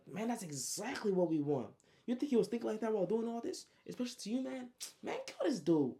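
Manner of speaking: high, insistent acted protest; heated urging. Trey pressing his hesitant friend to finish it, heated. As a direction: push a friend hard, pitch climbing up high.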